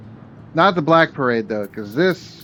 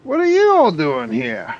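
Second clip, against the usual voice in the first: character voice; 10 semitones higher, 5 dB louder than usual. Acted.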